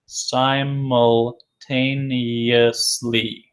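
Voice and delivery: Simultaneously drawn out very slowly, syllable by syllable; teacher stretching out Simultaneously; slow model reading. Shown as a demonstration. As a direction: sound out Simultaneously slowly for the class.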